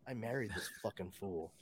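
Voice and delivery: low, very soft stunned muttering; quietly appalled at her husband; wife's dismay. Retold or imitated. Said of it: "She was just".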